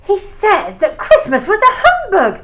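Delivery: laughing through the words, amused. Acted. Nephew laughing to his guests about his uncle. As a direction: chuckle it out at the old man's expense.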